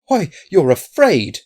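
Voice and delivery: high, loud, slow blurt of surprise; involuntary cry. A narrator's reading. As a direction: cry it out before you can stop yourself. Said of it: "cried Hobey involuntarily".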